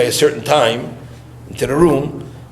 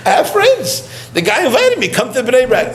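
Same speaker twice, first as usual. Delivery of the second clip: much higher, laughing protest; laughing retort; 10 semitones higher than usual. Retold or imitated. To the Rebbe, insisting he has friends, high and laughing. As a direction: laugh through the protest, pitched way up. Retelling his reply to the Rebbe.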